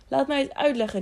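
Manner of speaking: mildly called-out plea; pleading call. A narrator's reading. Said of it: "riep Tiuri".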